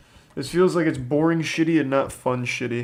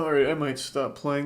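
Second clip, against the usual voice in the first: ordinary talk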